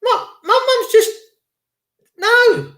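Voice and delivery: high, shocked, breaking cry of disbelief. Retold or imitated. Daughter hearing the doctor's covid ruling, shocked and tearful. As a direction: gasp it out like a daughter hearing her mum's death called covid. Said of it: "She went".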